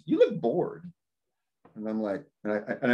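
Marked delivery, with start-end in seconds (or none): 0.0-0.8 higher, lightly voiced girl teasing; a hint of a young woman's voice; girl at party. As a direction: toss it off like a girl at a keg party. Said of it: "She goes".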